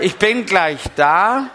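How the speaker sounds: loud, slow sing-song call to the guests; mock-humble call from the toilet; showy call. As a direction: announce it so everyone hears, hammed-up. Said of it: "Sag mal".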